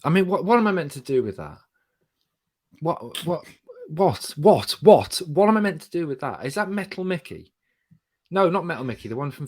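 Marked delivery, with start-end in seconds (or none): 0.0-7.5 baffled. Real, not acted. Baffled reaction to robot voice.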